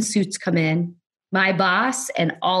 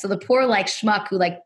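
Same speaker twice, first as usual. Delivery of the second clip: normal talking voice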